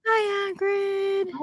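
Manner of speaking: high, cooing greeting to a pet. Real, not acted. Friend greets the dog on camera, high cooing baby talk.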